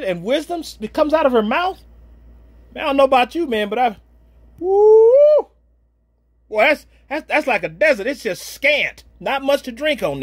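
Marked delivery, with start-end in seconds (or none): 4.6-5.5 a high, loud joyous whoop; excited whoop. Real, not acted. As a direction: let out a whoop of delight. Overcome by praise of wives.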